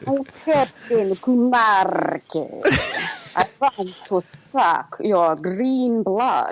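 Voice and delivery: slow, drawn-out Dracula-style Transylvanian accent. Shown as a demonstration. Performing the rejected captain voice on request, hammed-up Transylvanian accent. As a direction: draw it out like a Transylvanian vampire. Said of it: "wanna hear the Transylvania accent".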